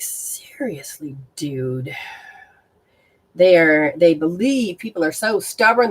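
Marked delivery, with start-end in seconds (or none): 0.0-1.9 breathy whisper, slow and exasperated; whispered aside. Retold or imitated. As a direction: mutter it under your breath, fed up. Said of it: "And I'm like".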